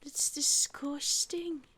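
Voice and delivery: slow whispered disgust. Real, not acted. Player whispering disgust at Mike's snotty gift. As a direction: breathe it out in revulsion.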